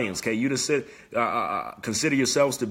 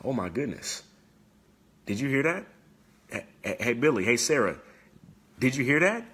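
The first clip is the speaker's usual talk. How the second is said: a lightly voiced, nosy startled aside, the questions pitched up; distracted lion. Preacher acting a distracted lion for viewers, mildly hushed and nosy. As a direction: play someone whose focus is broken by gossip, half-hushed. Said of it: "here's what some of you do".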